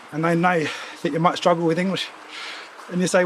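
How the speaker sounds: ordinary talk, nothing marked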